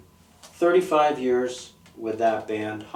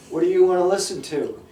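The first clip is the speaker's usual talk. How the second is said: higher, louder, rushed put-on voice; repeated mock question; 6 semitones higher, 6 dB louder, faster than usual. Driver pressing his friend for music, louder and quicker. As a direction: fire the question off again, impatient. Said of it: "I go".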